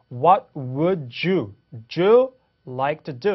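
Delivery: "would you" as wu-joo, slowly; linking demo. Shown as a demonstration. Teacher explaining D plus Y, "would you" with a J. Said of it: "j 사운드예요".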